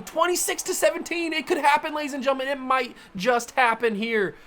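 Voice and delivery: high, thrilled shouting; excited yelling. Real, not acted. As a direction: cheer the lead like a fan. Super Bowl lead in game.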